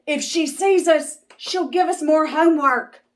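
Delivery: high, loud, fast panicked whisper-shout; scared kids. Acted. Kids hiding from Miss Swamp, high and panicked. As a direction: hurry it out in fear.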